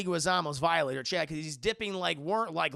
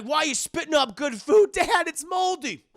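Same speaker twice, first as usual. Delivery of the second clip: high, loud indignant yelling; hammed-up high-pitched rant; high mock yell; 9 semitones higher, 10 dB louder than usual. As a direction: shriek it out in outrage. Abusive dad scene, yelling.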